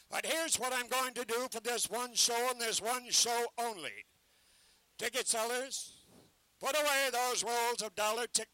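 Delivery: loud ballyhoo, selling hard to a crowd; carnival barker pitch. Acted. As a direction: bark the show to passers-by.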